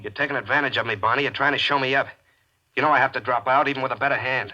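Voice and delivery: put-on a cornered young man raising his voice; bitter protest. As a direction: push back hard at the bully. Cornered gambler protests at table.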